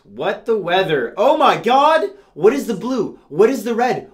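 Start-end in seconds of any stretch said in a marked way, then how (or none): 1.2-4.1 mock panic. Mock panic at weather map.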